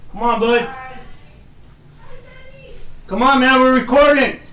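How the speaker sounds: loud, high, hurrying call; impatient call. Real, not acted. Dad hurrying his son onto the recording, loud impatient call. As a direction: get him moving, we're live.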